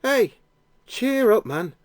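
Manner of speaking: cheery northern English voice, lifted up high; northern accent. A narrator's reading. A northern stranger cheers up the blind beggar, light northern accent. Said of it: "a voice in a northern accent".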